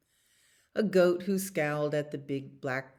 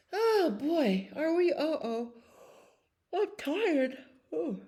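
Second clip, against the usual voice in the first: pretend sleepy; 12 semitones higher than usual.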